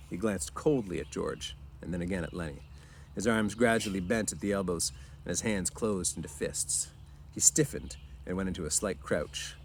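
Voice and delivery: ordinary delivery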